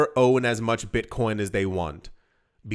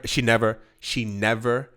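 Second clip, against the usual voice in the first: ordinary talk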